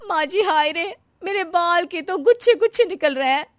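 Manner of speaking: panicked bride. Acted. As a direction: cry out in shock. Bride finds hair falling out.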